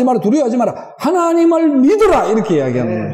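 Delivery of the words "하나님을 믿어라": higher, ringing preacher's cry; fervent exhortation. Acted. Preacher urging the congregation to trust God, fervent cry. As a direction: lift the command to the congregation.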